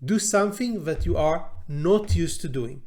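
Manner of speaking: usual speaking voice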